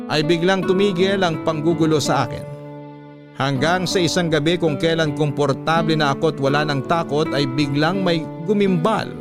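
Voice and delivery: usual speaking voice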